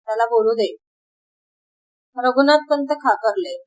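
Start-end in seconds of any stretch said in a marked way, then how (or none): none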